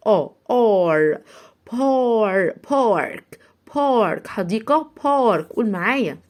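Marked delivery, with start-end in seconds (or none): 0.1-1.2 slow, louder "آ، آر" with a long open a; demonstrating the sound. Shown as a demonstration. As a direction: stretch the a before r. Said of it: "بننطقه آ".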